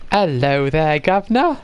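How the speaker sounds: hammed-up fake British greeting; Americans' fake British accent. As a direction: put on a bad British accent, lifting the last word. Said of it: "Americans try British accents".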